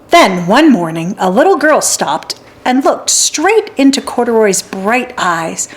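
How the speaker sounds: ordinary delivery, nothing marked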